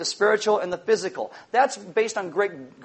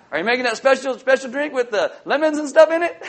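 a touch of eager, higher guest voice; 5 semitones higher, 4 dB louder than usual. Retold or imitated. Neighbor eagerly asking about the party drink, lightly excited. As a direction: lift it up like a keen guest.

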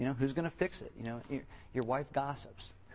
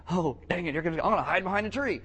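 quick, higher mock panic; caught sinner panicking; 5 semitones higher, 6 dB louder, faster than usual. Preacher mimicking a sinner hiding from God, mock panic. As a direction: blurt it out like a kid caught hiding. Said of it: "so they're like".

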